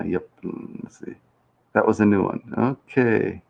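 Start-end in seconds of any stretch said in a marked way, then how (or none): none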